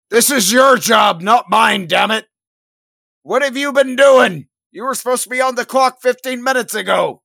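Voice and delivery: loud, high-pitched angry outburst; angry scolding. Acted. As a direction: shout at a colleague who turned up late. Freaking-out medic scolds late colleague.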